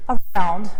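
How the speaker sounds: ordinary delivery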